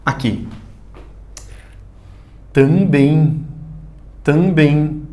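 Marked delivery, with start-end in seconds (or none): 2.5-5.0 "Também" sounded out as tam-bẽi; pronúncia exata. Shown as a demonstration. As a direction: say "Também" deliberately, ending in a nasal ei glide. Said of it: "saber exatamente a pronúncia". ("Também" pronunciado como se fala: tambẽi)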